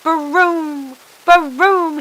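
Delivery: loud, high roaring engine noise. A narrator's reading. The truck blasting into overdrive, loud engine roar. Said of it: "he roared".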